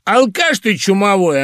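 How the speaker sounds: loud, high indignant outburst; offended. A narrator's reading. Irina insulted by Phil, indignant outburst. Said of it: "оскорблённо вскочила".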